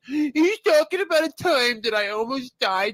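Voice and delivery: high, loud whiny friend voice; Ray impression. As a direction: squeak it out as the indignant buddy. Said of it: "that's my Ray impression".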